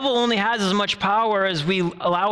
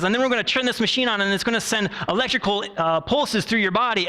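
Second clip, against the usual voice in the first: fast, higher, cheerful rush; bubbly cheerleader therapist; 4 semitones higher, faster than usual. Retold or imitated. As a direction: breezily announce the shocks, much faster. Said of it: "bubbly and happy and all cheerleader-like".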